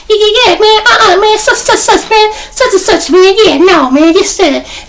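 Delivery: very high, squeaky babble. Retold or imitated. Imitating the big inmate's funny voice, squeaky. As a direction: squeak it out like a cartoonish big man. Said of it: "he had a real squeaky voice".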